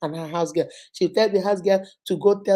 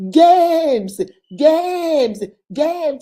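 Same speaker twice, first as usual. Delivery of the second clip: loud, higher call of "James" fading each time; a higher, louder summons that softens each time; 7 semitones higher, 8 dB louder, much slower than usual. Retold or imitated. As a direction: call across the house for James. Wife calling out to James.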